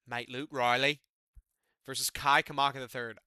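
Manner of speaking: put-on half-hearted British accent; bad British accent. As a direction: announce the bout like a mock British fan. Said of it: "I'm not gonna do the British accent anymore".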